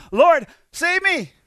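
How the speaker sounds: loud, slow desperate cry for help. Retold or imitated. Preacher voicing Peter sinking in the water, crying out loud. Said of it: "he cries out".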